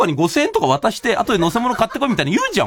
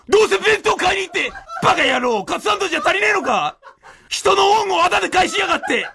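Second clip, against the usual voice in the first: higher, hammed-up angry scolding; mock tirade; 7 semitones higher than usual. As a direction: play the grumpy master. Imagined tirade at disciples.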